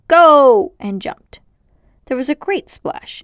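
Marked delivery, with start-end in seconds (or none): 0.1-0.7 loud, sudden shout on go; two children jumping into a pool, shouting go. Acted. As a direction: launch the jump with a big shout. Counting before jumping together.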